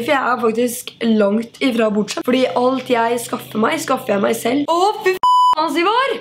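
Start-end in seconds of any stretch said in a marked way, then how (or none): 4.7-6.2 annoyed outburst. Real, not acted. Motorcycle noise while filming.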